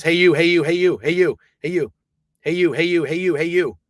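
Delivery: loud, high repeated calling out; demo yelling. Shown as a demonstration. Showing how loud he yelled in the booth. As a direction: call out loudly over and over. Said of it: "Was I like this?".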